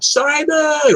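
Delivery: high call up to a window; yelled name. Retold or imitated. Visitor yelling up to a friend's house, high drawn-out holler. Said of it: "you yelled".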